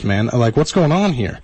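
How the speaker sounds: normal talking voice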